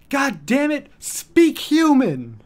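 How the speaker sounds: high, loud, drawn-out yell; mock panicked yell. Ian Hecox yelling at the barking dog, high loud yell. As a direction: yell it like a panicked sketch character. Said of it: "Ian Hecox goes".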